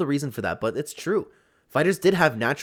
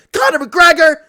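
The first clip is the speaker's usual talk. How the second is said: much higher, loud mock cry of a name; mock yell; 11 dB louder than usual. Chandler yelling McGregor's name, hammed-up loud cry. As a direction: belt the name out like a hammed-up shout. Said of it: "he yells Conor when they get it on".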